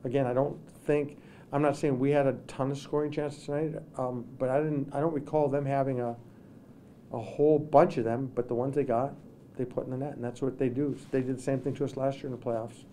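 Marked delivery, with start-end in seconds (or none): none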